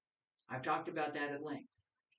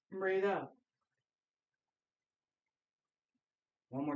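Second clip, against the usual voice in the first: steady instructor cues, exhale words pushed out; guided qigong cues; 3 semitones lower than usual. Acted. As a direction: lead the class through breath and movement. Leads qigong breathing practice.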